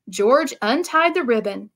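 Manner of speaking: usual speaking voice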